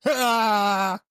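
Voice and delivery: high, loud imitated laugh burst; hammed-up impression of his laugh; mock laugh yelp. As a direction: squeal it out to tease him. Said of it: "And you'd be like".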